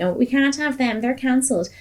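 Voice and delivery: high, mocking whine about cancelling; a high, mocking snowflake whine; mock whinging. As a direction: sneer like a crybaby complaining. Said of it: "this boo-hoo whinging".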